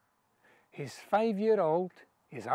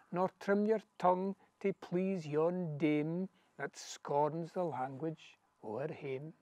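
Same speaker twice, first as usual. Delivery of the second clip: normal talking voice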